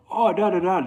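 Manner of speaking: high-pitched sing-song filler for someone's remark; mock commenter. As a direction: toss off a high, mocking placeholder quote. Mimicking someone's online comment.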